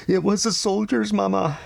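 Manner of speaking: put-on high, tearful boy's voice; crying child. A narrator's reading. Ramon to his mother after the beating, crying. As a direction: tell it through sobs. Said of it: "Ramon cried".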